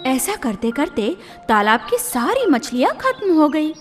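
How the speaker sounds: plain reading voice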